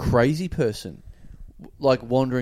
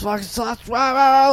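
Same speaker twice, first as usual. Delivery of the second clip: louder, higher mock rah-rah rant; mock crazy ranting; 10 semitones higher, 5 dB louder than usual. Crazy man pacing the street, hammed-up yell. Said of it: "like this".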